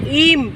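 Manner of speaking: "ইম" said high and distinct; isolated word ending. Shown as a demonstration. Parent correcting the child's answer, "ইম" sounded out clearly. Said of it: "কি উচ্চারণ হচ্ছে".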